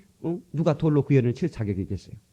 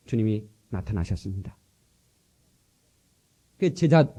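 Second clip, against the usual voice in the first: ordinary delivery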